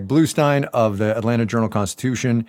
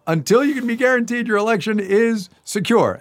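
high, loud rallying cry; hammed-up urgent proclamation; mock alarmist; 12 semitones higher, 4 dB louder than usual. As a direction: sound the alarm like an overwrought campaigner. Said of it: "some other attorneys have been saying".

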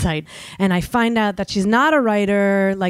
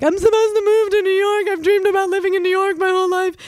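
high, tearful mock wail, much higher; imitating sobbing caller; 12 semitones higher, faster than usual. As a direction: imitate a caller sobbing over her lost dream. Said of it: "people were, like, sobbing".

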